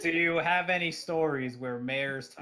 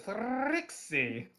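higher pony voice, rolled R; Trixie voice; 8 semitones higher than usual. Retold or imitated. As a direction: roll the R like Trixie. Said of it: "keep rolling your R's though".

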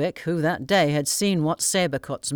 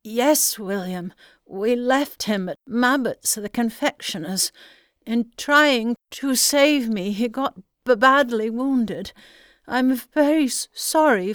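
stammering; 6 semitones higher than usual. A narrator's reading. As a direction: stumble over the words, shaken. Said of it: "stammered out".

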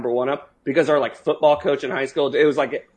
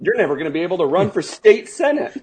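laughing; 5 semitones higher, 4 dB louder than usual. Real, not acted. Teasing while laughing.

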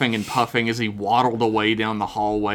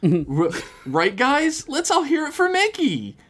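put-on high girl voice; 14 semitones higher than usual. Sherry urges awkward crowd.

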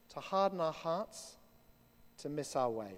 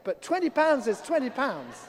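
laughing punchline; 6 semitones higher, 4 dB louder than usual. Retold or imitated.

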